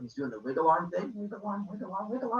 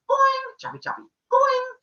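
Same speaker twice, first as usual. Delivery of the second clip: very high, louder boing and choppy; cartoon sound effects; 5 dB louder than usual. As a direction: squeak out silly cartoon noises.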